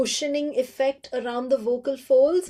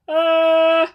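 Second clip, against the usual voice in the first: tight, loud squeezed grunt; throat squeeze sound; 5 semitones higher, 10 dB louder than usual. Shown as a demonstration. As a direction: clamp the throat on an uh. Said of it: "that kind of a squeeze".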